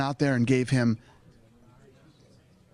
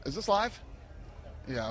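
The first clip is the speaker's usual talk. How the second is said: normal talking voice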